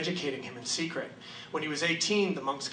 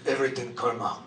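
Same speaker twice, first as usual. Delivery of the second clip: monk's calm answer; 6 semitones lower than usual. Retold or imitated. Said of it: "he turns around ... and he goes".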